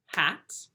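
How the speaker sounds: "hat" as one isolated, crisp beat; one clapped syllable. Shown as a demonstration. Teacher practicing with pupils at home, "hat" as one beat. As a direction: say "hat" on a single clap. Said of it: "Can you practice that with me?".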